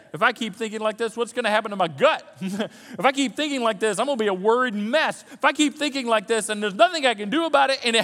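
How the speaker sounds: high, loud, driving preacher voice; full-force preaching. Acted. Preacher urging the congregation to direct their thoughts, full-force preaching. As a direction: press the point hard to the congregation. Builds worried inner monologue.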